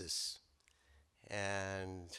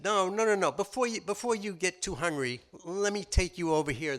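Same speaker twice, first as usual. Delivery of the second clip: high, louder rapid protest; pleading rabbit; 8 semitones higher, 6 dB louder than usual. Acted. Rabbit begging the lion not to eat him yet, high and pleading. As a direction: beg the lion to wait, pitched up.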